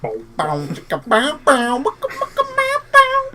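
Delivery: high sung bow chicka wow wow; porn groove vocalized. Retold or imitated. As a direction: sing the cheesy porn riff, rising high. Imitating smooth jazz porn music.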